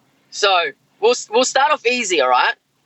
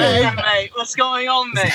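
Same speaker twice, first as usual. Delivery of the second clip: Australian accent; slower than usual. Real, not acted. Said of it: "Accent, that's fucking amazing".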